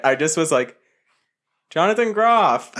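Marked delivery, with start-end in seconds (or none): 1.7-2.7 calling out to a star, not screaming; called out, not screamed. Retold or imitated. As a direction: call his name across the street. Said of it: "didn't scream it".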